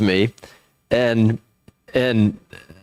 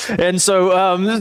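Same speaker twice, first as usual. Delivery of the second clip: ordinary talk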